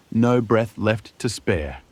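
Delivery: ordinary delivery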